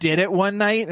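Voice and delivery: normal talking voice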